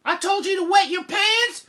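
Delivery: very high, incredulous mom; scolding mother. Acted. Mother questioning Jack about his pants, incredulous. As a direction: squeal the question in exasperation.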